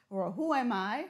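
ordinary talk

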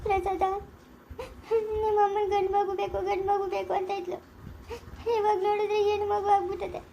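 weepy new mother pouring out her worry; tearful lament. Acted. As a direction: sob through a helpless plea. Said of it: "ಯಾಕೆ ಅಳ್ತಾ ಇದ್ದೀರಾ ನೀವು?".

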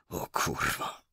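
hushed, whispered curse. A narrator's reading. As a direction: swear under your breath in a whisper. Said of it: "wyszeptał i potrząsnął głową". (przekleństwo szeptem)

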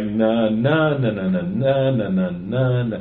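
na, na chanted in mixed groups of two and three; song rhythm demo. Shown as a demonstration. Showing co-hosts how song rhythm differs, chanting. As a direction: bounce the beat back and forth. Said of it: "but usually with music".

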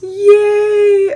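excited cheer. Real, not acted. Celebrating the gift.

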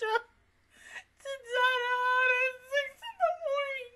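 high, squealing, near-tearful gush. Real, not acted. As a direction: overcome with fangirl emotion, voice way up. Gushing about a touching episode.

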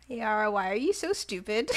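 ordinary talk, nothing marked

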